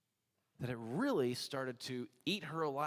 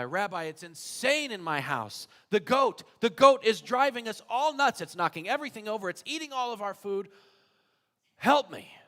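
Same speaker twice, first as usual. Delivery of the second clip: higher, louder exasperated pleading; an overwhelmed father begging his rabbi; frantic complaint; 7 semitones higher, 9 dB louder than usual. Acted. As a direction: beg for help, fed up with the goat. Man complains about the goat.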